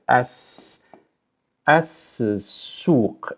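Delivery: "as-suq" split, with a long hissed s; s pronounced twice. Shown as a demonstration. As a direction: break after "as", then begin "suq" on a fresh s. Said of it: "you kind of pronounce it twice".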